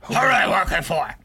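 loud, high mock-fierce demand; interrogator's shout. Acted interrogation of gray guy.

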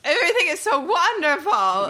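a lightly voiced, gushing artsy type; mock gushing artist. The artist describing his life, lightly voiced as gushing. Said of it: "he's talking like".

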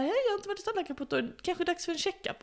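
rushed retold phone call. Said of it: "och bara så här".

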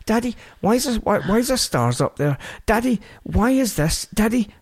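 put-on a high, eager little-girl voice firing questions; imitating toddler. Dad imitating his daughter chattering in the car, high and eager. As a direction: pitch up into a small child pestering daddy.

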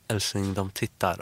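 soft, hushed warning; hushed aside. Retold or imitated. Telling her partner softly that strangers are looking. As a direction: lean in and murmur it.

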